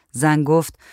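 ordinary delivery, nothing marked

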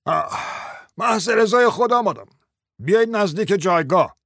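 irritated, high-pitched judge's outburst; ill-tempered. Acted. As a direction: summon them with exasperated impatience. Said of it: "با اوقات تلخی گفت".

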